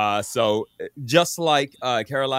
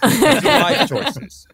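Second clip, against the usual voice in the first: laughing; 12 semitones higher, 9 dB louder, much slower than usual. Real, not acted. Laughing through his own joke.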